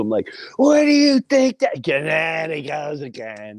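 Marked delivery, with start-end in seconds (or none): 0.6-1.8 much higher, louder outburst; retold shout. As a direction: shout it out in the fight. Retold argument.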